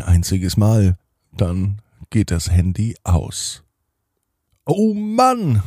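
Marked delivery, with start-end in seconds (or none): 4.7-5.6 much higher, drawn-out letdown; a kid groaning that the phone died; enttäuschter Seufzer. Acted. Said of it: "sagt Leo". (Leo, enttäuscht, hoch und gedehnt)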